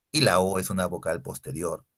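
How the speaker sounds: ordinary talk, nothing marked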